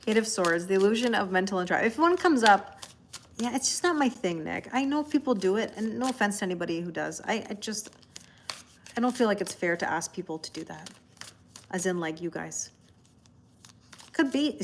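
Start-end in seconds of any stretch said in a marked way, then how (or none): none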